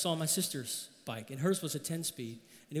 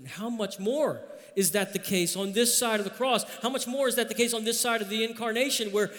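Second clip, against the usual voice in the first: preaching build; 6 semitones higher, 3 dB louder than usual. Acted. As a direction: climb into the point with fervor. Sermon build, pitch up.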